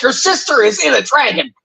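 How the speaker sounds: high, exasperated "your sister is in a dragon". Retold or imitated. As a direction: point out the obvious in disbelief. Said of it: "I'm like".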